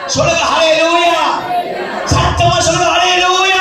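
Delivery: high, insistent preacher's proclamation; rousing preaching. Acted. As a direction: build to a high-pitched rallying call for hallelujahs. Preaching builds to rallying hallelujahs.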